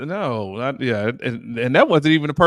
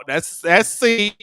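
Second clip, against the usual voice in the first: slow, high, raised-voice correction; loud call-out; 7 semitones higher, 5 dB louder, much slower than usual. Retold or imitated. Gate agent publicly correcting a passenger's group, loud call-out. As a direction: draw it out loudly for everyone to hear. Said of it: "They said loud".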